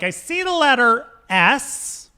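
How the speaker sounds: slow, high mystic medium; drawn out like a stage psychic; mock psychic.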